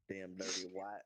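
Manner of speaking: mock Mississippi accent. Swapping from Canada to the South for friends, Mississippi accent. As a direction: lean into a put-on Mississippi accent. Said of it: "all the way to Sippy".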